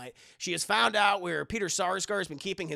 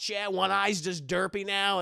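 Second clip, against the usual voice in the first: ordinary delivery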